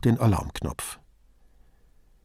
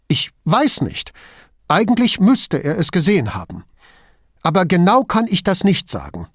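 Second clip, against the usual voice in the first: higher-pitched hesitant answer; uncertain, pitch lifted; 7 semitones higher, 4 dB louder than usual. A narrator's reading. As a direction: answer tentatively, unsure of yourself. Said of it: "Duso antwortete zögernd".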